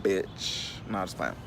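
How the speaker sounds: breathy whispered aside, mock tough; whispered bitch. Teasing Brendan from the south side, whispered aside. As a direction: drop it to a whisper like a secret.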